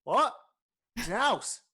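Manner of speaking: loud, high rising question; baffled orc innkeeper. Acted. Orc barkeep puzzled by talk of letters, loudly incredulous. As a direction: echo it in loud disbelief.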